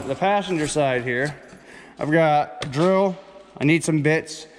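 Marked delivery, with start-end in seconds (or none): none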